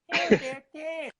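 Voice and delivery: ordinary talk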